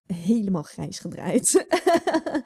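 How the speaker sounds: slow, careful Dutch "Helemaal grijs gedraaid"; Dutch saying. Shown as a demonstration. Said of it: "In Dutch, we have".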